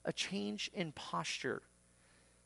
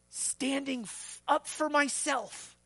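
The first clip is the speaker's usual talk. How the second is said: high, slow, loud declaration; self-righteous defiance; 8 semitones higher, 6 dB louder, slower than usual. Acted. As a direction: declare it slowly, standing your ground. Acted proud self-defender's answer.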